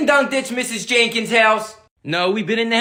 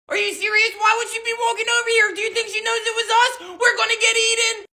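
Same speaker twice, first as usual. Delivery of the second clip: panicky; 10 semitones higher than usual. Acted. Panic as neighbor approaches.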